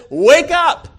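high, loud call to rouse someone; shouted wake-up call. Acted. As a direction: call out hard to wake the sleeper. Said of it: "calls you by name and says".

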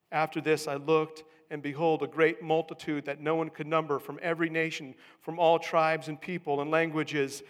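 loud, emphatic Bible reading; preached scripture reading. Acted. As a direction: proclaim the passage to the congregation. Reads scripture aloud with raised force.

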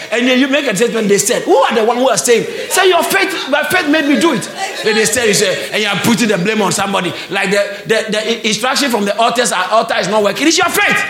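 loud, high, driving sermon; full-force preaching. Real, not acted. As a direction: hammer the point home to the congregation.

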